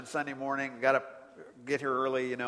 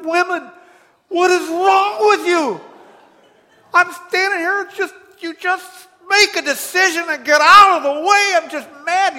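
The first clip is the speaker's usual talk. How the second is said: much louder and higher, fed-up rant; exploding frustration; 14 semitones higher, 16 dB louder than usual. Retold or imitated. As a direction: let it burst out like you can't hold it any longer. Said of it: "I just wanted to explode".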